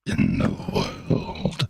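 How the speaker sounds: low, slow, breathy echo; slow, dropped-down faux echo; echo effect. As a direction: drag the words out like an echo in a hall. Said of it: "is there an echo?".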